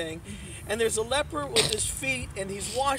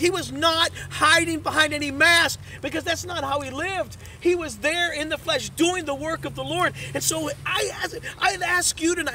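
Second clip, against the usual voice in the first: louder, rising sermon delivery; full-force pulpit preaching; 3 semitones higher, 5 dB louder than usual. Acted. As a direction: drive the message home to the congregation.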